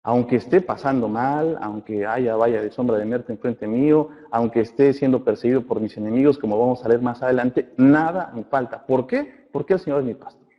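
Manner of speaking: usual speaking voice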